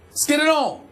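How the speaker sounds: sarcastic. Real, not acted. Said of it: "So now you're sounding sarcastic".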